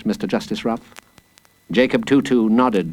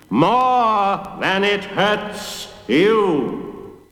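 high, hugely drawn-out chant; crowd calling back; 9 semitones higher, much slower than usual. A narrator's reading. Big people answering the judge, calling back slowly. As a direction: stretch every word in a chorus-like reply. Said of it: "the big people replied".

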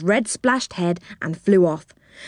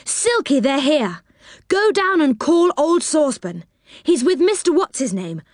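high, eager call; excited calling out; 7 semitones higher than usual. A narrator's reading. Moonface calling Silky to fetch Saucepan, excited and high. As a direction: call a friend over with rising excitement.

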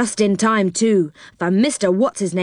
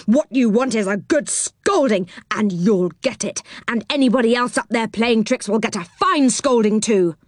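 higher voice of an angry little man; angry What's-His-Name; 6 semitones higher than usual. Acted. What's-His-Name scolding the children, higher and angry. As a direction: tell them off from below the tree.

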